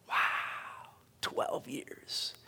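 very soft, whispered amazement; hushed awe. Real, not acted. Preacher marvelling at twelve years in prison, hushed awe. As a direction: let it out in a stunned whisper.

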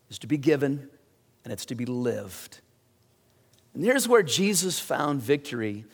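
ordinary delivery

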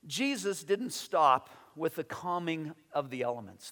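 usual speaking voice

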